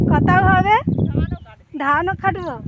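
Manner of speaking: loud, firm call. Real, not acted. Girl calling back to her mother across the paddy, loud. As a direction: project the reply far across the field.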